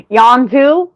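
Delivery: imitating country accent. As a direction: echo the country phrase as a question. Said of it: "my country hick voice".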